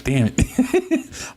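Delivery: high, laughing curse at himself; laughing frustration. Real, not acted. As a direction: laugh it off, annoyed at the slip. Frustrated at own stumble.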